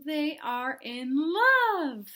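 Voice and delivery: slow, drawn-out and sweet; slowly swooning; dreamy Piggy. Acted. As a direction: savor the word love.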